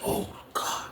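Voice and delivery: breathy whispered oh my God; hushed disbelief under the breath; whispered reaction. Retold or imitated. Recalling in his cell, a hushed whisper.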